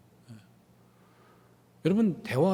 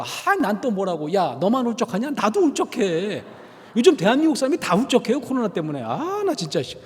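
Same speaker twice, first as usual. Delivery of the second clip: loud, pitched up, brushing it off; irritated husband; 5 semitones higher, 5 dB louder than usual. Retold or imitated. Husband brushes off his wife's sadness, loud and irritated. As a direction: snap back dismissively.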